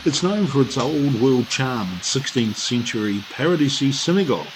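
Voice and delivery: even documentary voiceover read; travel narration. Acted. Reads tourist description.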